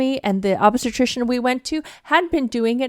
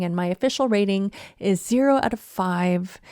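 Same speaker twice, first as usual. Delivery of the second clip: normal talking voice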